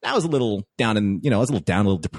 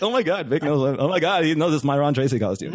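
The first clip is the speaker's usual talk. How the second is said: higher, breathless fan disbelief; starstruck excitement; 5 semitones higher than usual. Retold or imitated. Fan realising her idol recognises the costume, starstruck. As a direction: gush it, barely believing it. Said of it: "I'm, like, all shaking and stuff".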